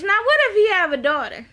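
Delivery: high, quick, emphatic question; pointed challenge. Acted. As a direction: throw it out as an excited challenge. Challenges character, then repeats it.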